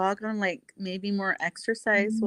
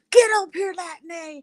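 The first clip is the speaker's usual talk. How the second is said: high, loud mock shout; imitating rowdy challengers; 9 semitones higher, 6 dB louder, slower than usual. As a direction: call someone out in a hammed-up yell. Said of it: "you guys were like".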